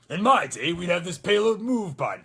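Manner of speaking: grumbling veteran, not gruff enough; Soldier seventy-six. Shown as a demonstration. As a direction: complain loudly as an old soldier. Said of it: "it's, it's gruffer".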